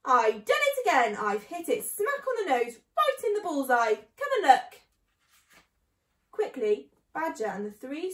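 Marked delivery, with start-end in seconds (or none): 0.0-4.8 loud, crowing excitement rising in pitch; triumphant shouting. A narrator's reading. Mister Fox calls the others up, shouting in triumph. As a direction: crow loudly over hitting the target. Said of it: "he shouted, jumping in the room above".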